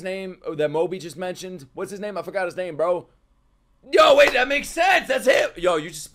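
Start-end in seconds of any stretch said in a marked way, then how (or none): 3.9-5.5 loud, high eureka; real hyped realisation; sudden realisation. Real, not acted. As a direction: snap to it, thrilled.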